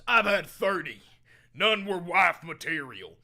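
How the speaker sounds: mock bro quote. Mocking a chatter who bragged about his body count. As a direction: boom it out like a bragging guy. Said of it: "Brother goes".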